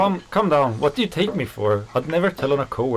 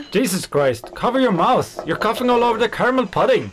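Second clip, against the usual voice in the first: higher, louder, sharp telling-off; raised scolding voice, fast at the end; scolding shout; 8 semitones higher, 3 dB louder than usual. Acted. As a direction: yell at a coworker who coughed on the food. Coworker scolds the coughing worker.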